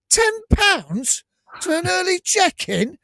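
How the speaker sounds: high, slow, indignant disbelief; mock outrage. Co-host retelling his shock at the hotel charge, high and drawn out. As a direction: stretch it out like you can't believe the price.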